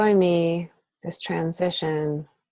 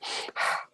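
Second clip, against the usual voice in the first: heavy exhale; 9 dB quieter than usual. Shown as a demonstration. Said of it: "it's like".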